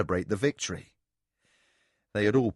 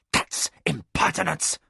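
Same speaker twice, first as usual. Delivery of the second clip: snapped; 4 semitones higher than usual. A narrator's reading. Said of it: "snapped Lujan".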